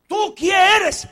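very slow, high and loud; demanding question. Acted. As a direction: drag out the challenge. Questioner again.

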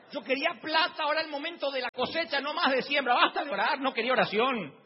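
high, loud comic complaining; hammed-up fed-up griping; exasperated rant. Continues comic rant about money.

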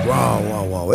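low growling lion roar; low mock lion growl. Lion roaring in forest.

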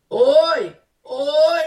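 high, loud repeated today; loud emphasis. Real, not acted. Preacher urging listeners to act now, loudly. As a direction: ring out the word hoy twice.

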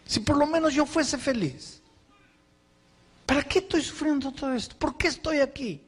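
high, pleading complaint back to his own heart; anguished questioning. Retold or imitated. Soaked pastor arguing with his own heart, high and pleading. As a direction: cry out the questions, high and hurting. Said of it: "Mi, mi corazón me decía".

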